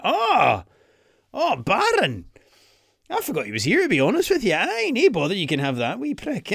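high, put-on Trump voice with Scottish words; a mocking Trump in a broad Scottish lilt; Donald Trump. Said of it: "He'd just be like".

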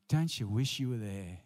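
usual speaking voice

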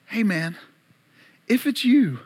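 doubting Peter, pitch lifting on YOU; sarcastic. Acted. Peter in the boat challenging Jesus, sarcastic. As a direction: challenge him with a sarcastic edge. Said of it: "with sarcasm in his voice".

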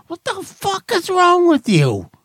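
high, strained mock outrage; mock rant. Real, not acted. Host ranting at retirees in high pretend outrage. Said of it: "I'm pretending".

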